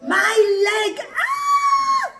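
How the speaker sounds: long, very high storybook scream; loud, shrieking mock agony. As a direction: scream in pain over a squashed leg, hammed-up. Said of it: "Bilam started to scream".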